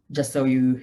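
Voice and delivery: ordinary delivery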